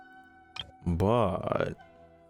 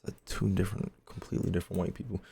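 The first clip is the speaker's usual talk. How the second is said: whispering; 3 semitones lower, 7 dB quieter, faster than usual. Real, not acted. Muttering aside to chat.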